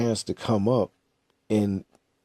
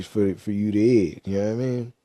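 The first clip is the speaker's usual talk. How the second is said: ordinary talk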